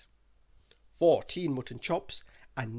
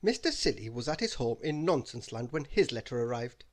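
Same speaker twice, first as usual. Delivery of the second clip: ordinary delivery, nothing marked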